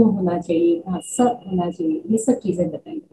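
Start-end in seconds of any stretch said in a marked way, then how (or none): 1.0-1.2 स said as a plain s syllable; Pali pronunciation. Shown as a demonstration. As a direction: show the Pali sound स as sa. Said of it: "उन्होंने मेरा पाली उच्चारण". (पाली उच्चारण में स की ध्वनि)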